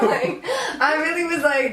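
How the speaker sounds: normal talking voice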